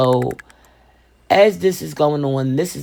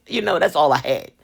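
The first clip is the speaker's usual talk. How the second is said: normal talking voice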